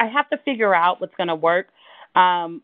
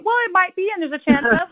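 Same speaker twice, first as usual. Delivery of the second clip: high-pitched smiling newscaster; mock newscaster with a high smile; 10 semitones higher than usual. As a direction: play the grinning weather girl. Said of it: "with a high smile".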